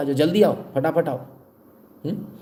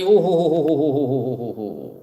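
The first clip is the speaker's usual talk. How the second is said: mocking ho ho ho; slower than usual. Mocking the fuss after quote.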